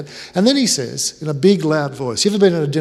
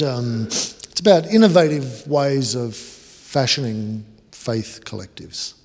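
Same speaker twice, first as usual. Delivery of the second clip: slow, droning jargon; slow, flat-toned jargon read; deliberately dull; slower than usual. Retold or imitated. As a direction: bore him on purpose, slowly. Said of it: "I'm gonna kill this conversation".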